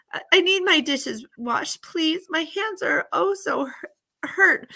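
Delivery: put-on high, pained old-lady voice, drawn out; needy old lady. Old lady begs girl to wash dishes, high and pained. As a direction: complain of aches, pitched high and pleading. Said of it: "the old lady says".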